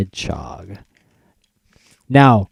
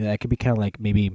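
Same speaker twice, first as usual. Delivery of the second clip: usual speaking voice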